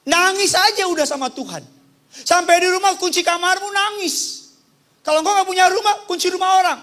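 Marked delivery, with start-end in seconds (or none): none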